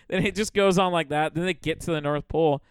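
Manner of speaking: usual speaking voice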